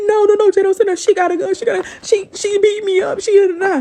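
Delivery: high, tearful pleading cry; frantic crying plea. Retold or imitated. Neighbor crying to Jay at the door, pleading in a high frantic voice. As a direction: beg in panic through tears. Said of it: "She like".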